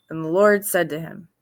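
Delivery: ordinary talk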